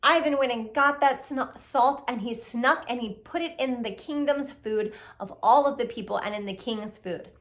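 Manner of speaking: ordinary delivery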